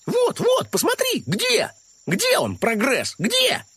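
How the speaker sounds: high, excited rabbit voice. A narrator's reading. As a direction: burst out with the discovery. Krosh insisting.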